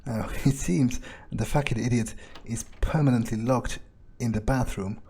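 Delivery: usual speaking voice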